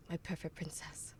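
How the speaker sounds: soft whispered endearment; whispering. Real, not acted. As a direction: whisper it tenderly.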